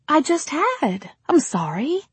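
put-on high, bright young actress. Rosemary replies.

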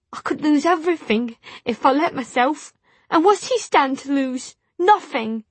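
high, loud tearful voice; bitter words through tears; crying. Acted. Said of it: "then the tears did fall".

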